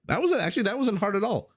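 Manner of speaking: proud yelp after getting it right; giddy relief; chuffed. Real, not acted. Pleased after nailing phrase.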